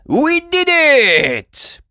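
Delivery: high, loud, slow victory cry; triumphant cry. A narrator's reading. Super Potato celebrating the saved universe, loud triumph. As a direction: cheer the win. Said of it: "cried Super Potato".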